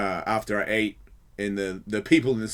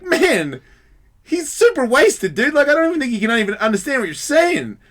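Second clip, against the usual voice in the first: high, loud appeal to an officer; protesting plea; 12 semitones higher, 7 dB louder than usual. Retold or imitated. Bystander appeals to police officer, protesting plea. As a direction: plead the drunk's case, voice raised. Pleading with officer for drunk man.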